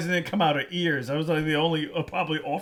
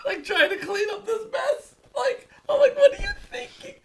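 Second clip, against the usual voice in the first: laughing. Real, not acted. Laughing while retelling the mess.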